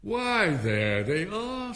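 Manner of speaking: high, loud cry of triumph; triumphant. A narrator's reading. King pointing to the tarts on the table, triumphant. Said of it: "said the king triumphantly".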